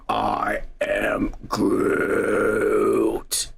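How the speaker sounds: low, slow Groot growl; I am Groot, deep and drawn out. Retold or imitated. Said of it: "he was going".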